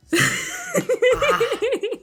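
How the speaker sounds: shrill breathless laughter; high squealing laugh. Real, not acted. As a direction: burst out in squeals. Laughing at friend eating pepper.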